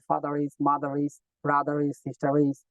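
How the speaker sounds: father is linked smoothly, very slow; linked words. Shown as a demonstration. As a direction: glide father is into one word, slowly. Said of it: "ফাদার ইজ কে একসাথে পড়তে হবে".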